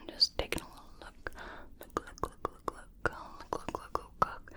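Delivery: doctor whispers during the ear check, very softly; faint close whisper. Acted. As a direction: breathe the words into the ear.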